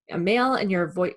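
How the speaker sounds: ordinary delivery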